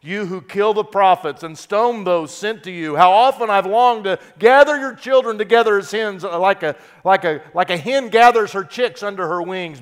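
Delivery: impassioned tearful cry to Jerusalem; crying lament. Retold or imitated. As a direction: cry out over the city. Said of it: "he was crying".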